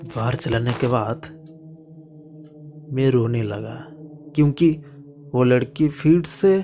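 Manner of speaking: plain reading voice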